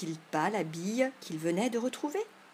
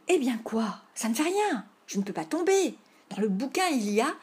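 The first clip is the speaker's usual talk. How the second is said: loud, higher squabbling retort; heated play-fight objection; indignant protest; 4 semitones higher, 6 dB louder than usual. Acted. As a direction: argue back, insisting on the book's rules. Boy protests he cannot fall.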